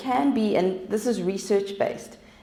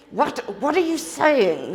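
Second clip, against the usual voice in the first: high-pitched irritated snap at a husband; hammed-up exasperated challenge, pitch swooping up; mock aggressive; 6 semitones higher, 3 dB louder than usual. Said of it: "you're so aggressive".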